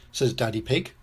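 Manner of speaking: ordinary delivery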